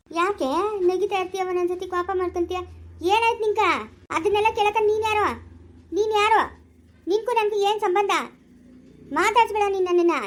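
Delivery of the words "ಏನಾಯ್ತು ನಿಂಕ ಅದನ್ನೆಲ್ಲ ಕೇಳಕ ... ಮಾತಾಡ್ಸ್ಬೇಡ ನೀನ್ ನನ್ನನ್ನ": high, loud furious retort; possessed girl yelling back at her aunt; angry shouting. Acted. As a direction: shout her down, who are you to ask. Possessed girl rebuffs aunt angrily.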